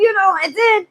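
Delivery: usual speaking voice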